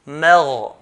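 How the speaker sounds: مغ with a clear voiced ghain; مغ said heavy from the throat; proper ghain. Shown as a demonstration.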